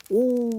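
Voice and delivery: high drawn-out "ooh"; imitated cry. Mid-explanation to listeners, an imitated high cry. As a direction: hold a high, thin hoot. Spelt cry inside retold phrase.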